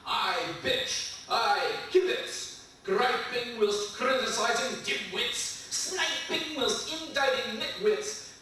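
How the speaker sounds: rhythmic, crisp poem recital; poetry reading. Acted. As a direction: read it like a performance poet. Reads poem to audience.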